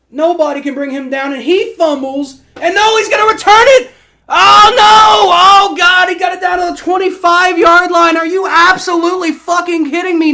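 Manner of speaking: high, loud anguished yelling; frantic shouting. Real, not acted. Streamer watching the fumble returned, frantic shouting. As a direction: scream at the TV as it unravels.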